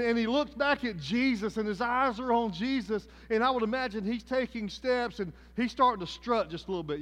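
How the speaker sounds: fiery revival preaching; preaching at full force. Acted. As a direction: preach it hard to the congregation.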